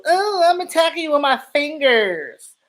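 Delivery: high, prissy mock voice of a timid player; a lightly voiced scared defender; mocking soft tackler. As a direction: make fun of a dainty tackler. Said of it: "They doing these little".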